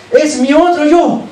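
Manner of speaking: lightly acted Adam, high and slow proclamation; exclaiming Adam. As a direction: proclaim it slowly, pitch lifted, as Adam's declaration. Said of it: "dice que Adán le dijo".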